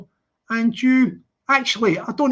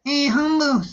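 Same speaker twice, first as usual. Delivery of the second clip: put-on Scouse accent; 4 semitones higher than usual. Streamer mimicking Expo telling Humble off, Scouse accent. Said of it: "That sounds Scouse".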